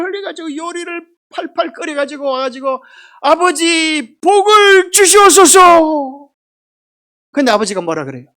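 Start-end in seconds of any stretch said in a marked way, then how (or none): 3.2-6.3 loud, slow, high calling out for the blessing; pleading shout. Retold or imitated. Esau to his father, shouting a slow plea.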